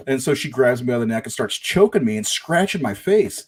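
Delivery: ordinary delivery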